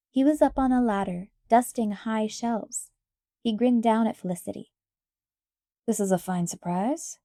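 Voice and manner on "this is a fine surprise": a touch lower, friendly apprentice; lightly voiced Ben. A narrator's reading. Ben on the ladder greeting Felicity, friendly. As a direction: grin down and greet her.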